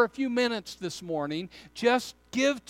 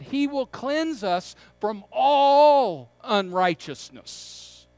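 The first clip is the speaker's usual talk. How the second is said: slow line with a long, loud "all"; stress on ALL; 5 dB louder, slower than usual. Shown as a demonstration. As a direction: stretch and punch "all", then ease off. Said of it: "Could I emphasize the word all".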